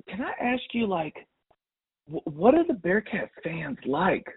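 high, slow, wondering question; curious mom voice. Retold or imitated. The mom asks the teller about Bearcat fans, high and slow.

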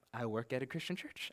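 meek retold reply. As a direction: answer timidly under your breath, imitating yourself. Retold meek reply to angry officer.

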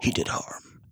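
slow, voiceless whisper; playful whisper. Pretend. Co-host tailing off the silly outro, whispered. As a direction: let the nonsense fade away in whispers.

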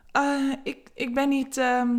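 lightly voiced, flustered hesitation; embarrassed stammer. Eva stammering shyly to Vera. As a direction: stumble over the words a little. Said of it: "zegt ze beschaamd".

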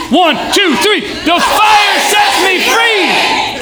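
very high, long-held yell; full-throated crowd battle cry; all-out rallying shout. Shown as a demonstration. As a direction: count in, then rip each word. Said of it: "So that security shows up".

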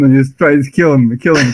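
ordinary talk

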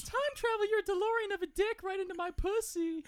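high, breathy-free squeal of a sultry Stacy; hammed-up porn line. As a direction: read the porn line in a high mock-sexy voice. Mock porno script reading as Stacy.

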